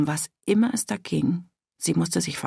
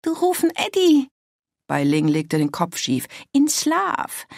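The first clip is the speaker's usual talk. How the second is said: much higher voice in broken German; young Chinese woman; 9 semitones higher, 3 dB louder than usual. A narrator's reading. Bai Ling telling Pitt he called Eddie, high and playful. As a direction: tease him lightly.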